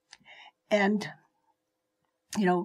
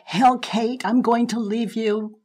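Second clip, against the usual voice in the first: husband yelling; 3 semitones higher, 5 dB louder than usual. Retold or imitated. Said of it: "he was saying things like".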